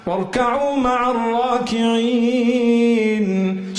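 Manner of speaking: fast recited Arabic verse; Quran recitation. Acted. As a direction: recite the verse in a chanting cadence.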